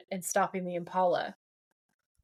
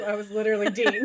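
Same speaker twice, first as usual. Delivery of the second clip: louder, quicker words breaking into laughter; laughing; 6 dB louder, faster than usual. Real, not acted. Host owning up to sounding like Dean, laughing. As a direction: say it through a real laugh. Cracking up at her own slip.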